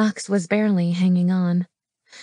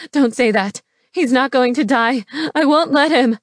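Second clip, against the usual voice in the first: raised voice, climbing higher and louder; screamed protest; 5 semitones higher, 4 dB louder than usual. A narrator's reading. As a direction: refuse to accept it, building to a cry. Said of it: "she screamed".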